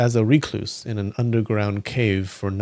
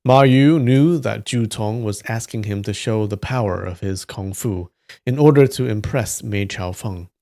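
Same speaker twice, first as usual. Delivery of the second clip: ordinary delivery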